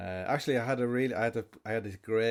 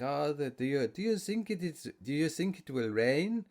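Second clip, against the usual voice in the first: mock French accent; 5 semitones higher than usual. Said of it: "dying to use that stupid line".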